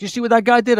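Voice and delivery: high, loud indignant demand; angry man. Retold or imitated. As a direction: voice the furious truck driver, way up and loud. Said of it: "He's like".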